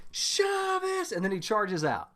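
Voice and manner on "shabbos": high group shout back; excited shout. Retold or imitated. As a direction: call it back high and bright. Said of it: "they're like".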